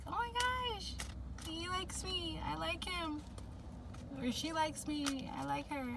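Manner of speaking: high, soft, breathy-free giddy schoolgirl gush; bashful crush. Retold or imitated. Reader imagining a crush, high and hushed. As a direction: coo it like a shy girl with a crush. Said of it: "It's very, like".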